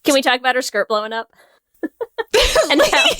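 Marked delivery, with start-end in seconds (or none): 1.8-2.6 bursting into a high giggle; a sudden squeal of laughter. Real, not acted. Laughing about Louise gawking at Thelma, high giggle.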